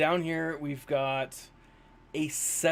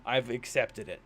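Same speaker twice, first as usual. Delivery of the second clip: normal talking voice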